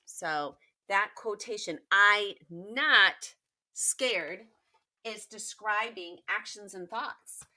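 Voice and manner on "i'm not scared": "not" punched loud; a firm "not" said loud and heavy; stress on not. Shown as a demonstration. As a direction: hit the word "not" hard. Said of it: "with that big, bold not".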